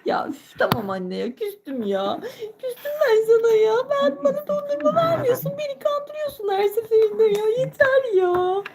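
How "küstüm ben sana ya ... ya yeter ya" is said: high whiny fake sob; mock crying whine. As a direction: whine it like a sulky child. Küsmüş ağlamaklı çocuk. (şımarık sahte ağlama)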